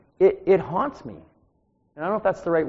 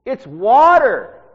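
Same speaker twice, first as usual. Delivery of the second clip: higher, louder, slow over-enunciated repeat; 9 semitones higher, 14 dB louder, much slower than usual. Retold or imitated. Preacher repeating to the deaf old pastor, loud and slow. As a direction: say it again for a deaf old man. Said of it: "maybe he didn't hear me".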